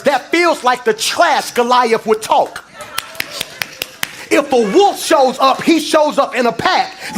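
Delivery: loud, high, ringing sermon climax; rising call-and-response preaching; full-force preaching. Acted. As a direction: preach it out to the whole church.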